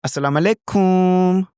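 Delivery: Indonesian pronunciation. Shown as a demonstration. Teacher showing the Indonesian way to say "Assalamu alaikum". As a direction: greet like someone from Indonesia. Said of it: "If you go to Indonesia".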